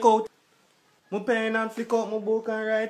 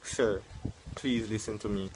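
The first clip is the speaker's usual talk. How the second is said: much lower, softer clerk voice; a deep, patient cashier brushing him off; lowered voice of a polite fast food worker; calm counter worker; 12 semitones lower, 8 dB quieter than usual. Acted. Worker pleading with customer.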